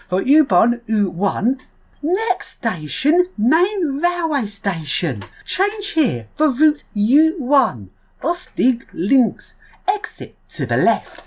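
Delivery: put-on slow, drawn-out station announcer with a German accent; mock German train announcement. A vlogger imitating a German train announcement slowly. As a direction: announce each stop slowly, like a German tram tannoy. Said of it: "don't laugh at my terrible German".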